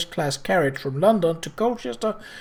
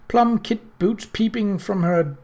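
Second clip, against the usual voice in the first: ordinary delivery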